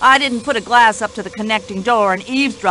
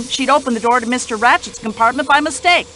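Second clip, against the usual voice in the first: ordinary talk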